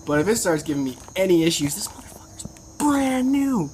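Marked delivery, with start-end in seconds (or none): 1.8-3.7 whispered, pitched up, this motherfucker's brand new; real giddy whisper over a new chain; gleeful whisper. Real, not acted. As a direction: hiss it like a secret thrill. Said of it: "gleeful over the chain".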